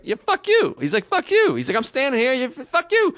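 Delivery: high, mock angry comeback; retold angry retort. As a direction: fling it back like a train quarrel.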